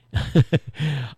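short nervous laugh. Real, not acted. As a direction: laugh it off, unsure. Nervous about running phone.

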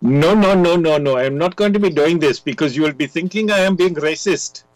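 put-on Indian English accent. Shown as a demonstration. Guest showing the host how he answers spam calls, Indian accent. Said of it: "I put on an Indian accent".

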